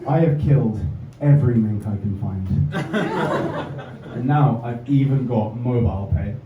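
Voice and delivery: ordinary talk, nothing marked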